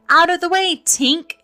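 high, loud, urgent shout; shouted warning. A narrator's reading. Toodles warns Tink as he aims, shouting. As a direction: shout to clear the line of fire. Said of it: "he shouted".

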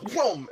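high imitated throwing sound; whoosh noise. Man throws beeper down, imitated whoom sound. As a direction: make the crash noise. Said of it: "throws it down and goes".

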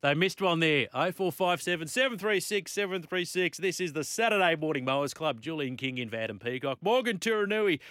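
upbeat radio host read; radio announcer. Acted. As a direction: sell the number like a radio promo. Radio announcer phone number plug.

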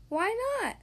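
high curious "why not"; innocent question. Acted. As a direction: ask it brightly and innocently. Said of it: "asked Wilbur".

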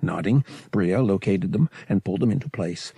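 ordinary delivery, nothing marked

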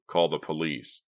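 ordinary delivery

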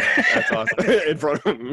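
high, loud amused laughter through words; laughing. Real, not acted. Host tickled by a tale of not crying, laughing. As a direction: crack up while repeating the punchline. Listener cracks up at the story.

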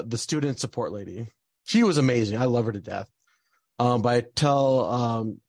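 ordinary delivery

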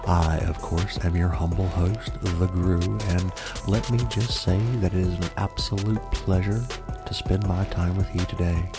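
spooky ASMR podcast host; horror host. Acted. ASMR murder podcast host intro.

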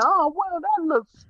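high-pitched mock fascination; high, impressionable voice; impressed onlooker. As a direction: pitch it way up, like someone easily tempted. Mimics easily influenced people impressed.

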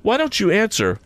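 put-on an anxious boy nudging Joe; young Pip. A narrator's reading. Young Pip urging Joe to answer. Pip's lines.

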